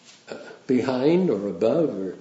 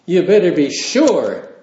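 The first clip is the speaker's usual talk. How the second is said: emphatic warning; 5 semitones higher, 8 dB louder than usual. Real, not acted. Preacher warning the congregation.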